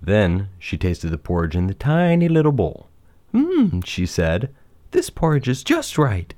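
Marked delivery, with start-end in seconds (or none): 1.7-2.9 small, high storybook emphasis; tiny-little voice. A narrator's reading. 3.3-4.5 put-on high-pitched little girl figure, satisfied; high, savoring storybook voice; Goldilocks, pleased. 4.9-6.3 higher-pitched, satisfied storybook heroine; high, approving character voice; Goldilocks, pleased. Acted.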